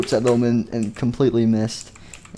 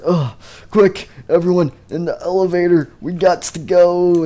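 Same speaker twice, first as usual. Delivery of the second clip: higher, louder, hurried rally, slowing at the end; urgent call; 6 semitones higher, 4 dB louder than usual. Acted. A character rallying everyone into the elevator, urgently. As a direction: hurry the group along.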